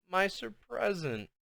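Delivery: almost too sad to speak; sad rasp. A narrator's reading. Sad child answering her mother, slow and soft. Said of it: "filled with so much sadness".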